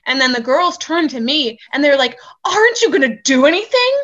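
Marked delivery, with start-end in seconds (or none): 2.5-3.9 loud, high-pitched challenge; re-enacted outraged appeal; imitated indignant demand. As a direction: demand action, voice raised. Girls demand bartender intervene.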